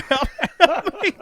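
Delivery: high, loud mock plea; imitated yell. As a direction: call out as the drunk stuck under a tree. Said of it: "like, yelling at me".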